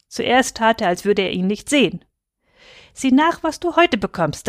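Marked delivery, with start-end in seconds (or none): 2.9-4.5 eager, high-pitched prodding; urging. A narrator's reading. Children urge father to open envelope, eagerly. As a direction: press him excitedly, voice pitched up. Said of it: "drängten wir".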